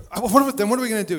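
high, loud and rushed; frantic, words spilling fast; bugged out. Retold or imitated. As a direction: gabble it in a panic. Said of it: "I was too bugged out".

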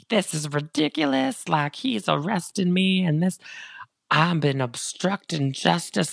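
high mock drunk whine, slowing at the end; drunk Reese. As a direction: whine it like a tipsy celebrity. Retelling Reese's drunk complaint.

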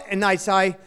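ordinary delivery

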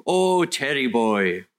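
slow, drawn-out imitated Welsh accent. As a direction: imitate an older Welsh pastor's lilting accent. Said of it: "He had a strong Welsh accent".

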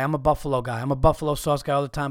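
usual speaking voice

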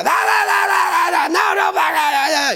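high-pitched gibberish rant; mock yelling. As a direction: imitate a sergeant yelling nonstop nonsense. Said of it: "Lo único que hacía".

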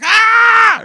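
loud, high yelp of alarm; full-voice "aah" shriek; retold yell. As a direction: let out a big high scream. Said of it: "I was like".

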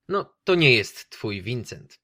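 ordinary talk

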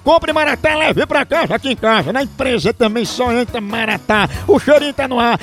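upbeat radio ad pitch for the coffee; coffee ad read. Acted. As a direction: sell the coffee like a live radio spot. Host reads coffee ad. (leitura de comercial animada)

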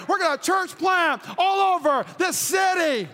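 building to high, forceful declarations; rally the congregation, phrase by phrase; fired-up preaching. Real, not acted. Preacher vowing to church plant the city, rising fervent preaching.

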